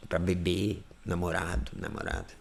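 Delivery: low, very soft murmur; intimate soft voice. Shown as a demonstration. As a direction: murmur it as to a sweetheart. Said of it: "pra alguém muito íntimo também a gente fala".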